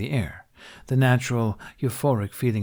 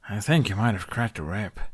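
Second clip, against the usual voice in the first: complaining. A narrator's reading. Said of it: "he complained".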